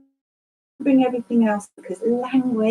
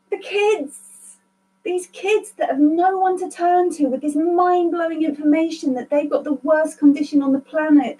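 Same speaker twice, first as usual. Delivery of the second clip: voice of the sign; 5 semitones higher than usual. Retold or imitated. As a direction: speak as the handkerchief urging her on. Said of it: "it's in the pocket".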